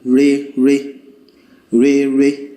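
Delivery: even mid notes re re. Shown as a demonstration. Teacher chanting re notes to show level tone. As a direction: chant re re on one level pitch. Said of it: "swap it around again and go".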